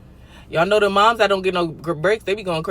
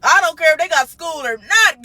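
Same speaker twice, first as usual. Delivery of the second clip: ordinary delivery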